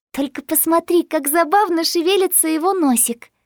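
put-on high-pitched, tickled girl's voice; charmed cooing. A narrator's reading. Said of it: "умилилась Джесс".

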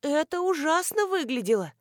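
high, frightened question; trembling voice. A narrator's reading. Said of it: "дрожащим голосом осведомилась Нина".

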